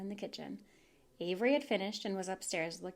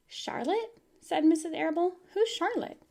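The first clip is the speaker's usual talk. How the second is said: high, startled question; mother caught off guard by a name; surprised mother; 9 semitones higher, 7 dB louder than usual. Acted. Mother hears of Charlotte, high surprised question.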